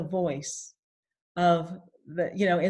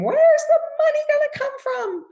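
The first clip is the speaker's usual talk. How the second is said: much higher, louder screamed question; mother screaming; 8 dB louder than usual. Retold or imitated. Mother panicking about money, screamed. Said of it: "my mother used to scream".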